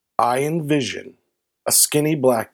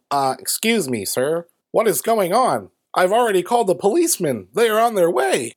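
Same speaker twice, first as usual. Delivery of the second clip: higher, prim mock neighbor voice; 6 semitones higher than usual.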